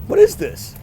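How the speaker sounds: sudden high "what is this?"; puzzled at finding honey in his basket; surprised question. Retold or imitated. Finds something in his basket.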